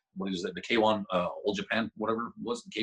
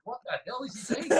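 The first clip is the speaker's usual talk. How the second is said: baffled; 11 semitones higher, 4 dB quieter than usual. Retold or imitated. Own baffled thought retold.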